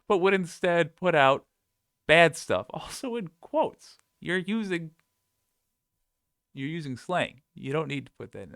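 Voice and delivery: high, ridiculing sing-song; high mock scolding. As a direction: send up the writer with a high voice. Ridiculing the post's wording.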